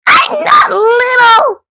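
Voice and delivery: high, slow indignant shout; hollering. Acted. Thistle protesting to Goose, shouted high. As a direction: yell it, offended at being called little. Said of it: "Thistle hollered".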